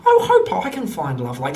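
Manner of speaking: mock teary, voice giving out then rising; choked-up gnome. Gnome moved by the wedding vows, mock teary. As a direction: play lightly overcome by the beauty of it. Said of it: "he's just so teary-faced".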